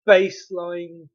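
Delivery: sudden loud, slow baseline; too loud. Real, not acted. Reviewer blurts out a word too loudly, loud. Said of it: "Oh, that's loud".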